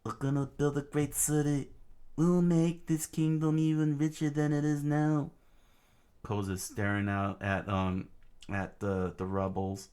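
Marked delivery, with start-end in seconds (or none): 0.0-5.3 put-on high, eager kid voice; child voice. Young boy telling a friend his plans, eager. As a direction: dream big like a child.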